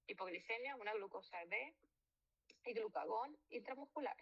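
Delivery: normal talking voice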